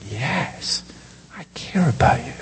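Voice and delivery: hushed tender promise; tender reassurance. Acted. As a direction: reassure gently, almost a whisper. Jesus reassuring the disciples.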